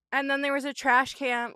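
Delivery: ordinary delivery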